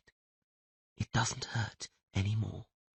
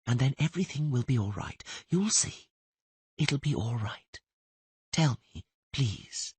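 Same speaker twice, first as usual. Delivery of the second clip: soft, quiet coaxing murmur; gentle soothing voice; 4 semitones higher, 6 dB quieter than usual. Acted. Kneeling stranger coaxing a dying man to talk, softly. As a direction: lull him while pressing for names. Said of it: "a quiet, gentle, soothing voice".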